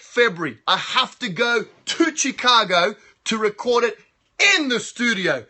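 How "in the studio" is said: normal talking voice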